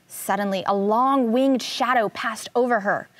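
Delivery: usual speaking voice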